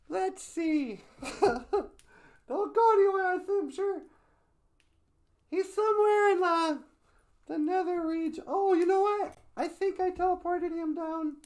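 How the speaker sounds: very high-pitched falsetto character voice; squeaky, put-on helper, puzzling things out; flustered teleporter helper. Sweetie tries to teleport the host away, flustered falsetto.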